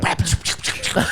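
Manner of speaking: rapid-fire unvoiced kick sounds; imitated flurry of chops and kicks; karate noises. Showing his jumping kicks at the car, karate noises.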